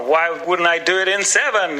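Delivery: ordinary talk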